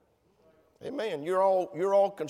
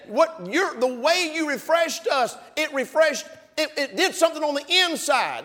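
high, loud fervent preaching of Paul's words; paraphrasing Paul, fervent; 9 semitones higher, 5 dB louder than usual. Retold or imitated. Preacher voicing Paul's praise to a brother, fervent and high. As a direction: lift it up with passion. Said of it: "But the Paul said".

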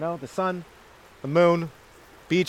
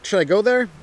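mock listener; 6 semitones higher, 3 dB louder than usual. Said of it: "You're like".

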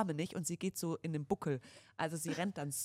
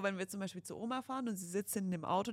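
usual speaking voice

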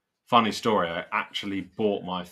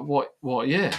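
high, louder excited question; eager surprise; 8 semitones higher, 5 dB louder, slower than usual. Retold or imitated. Buyer asking seller about the old Triumph, eagerly surprised. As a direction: blurt it out in keen disbelief. Retold eager reaction to offer.

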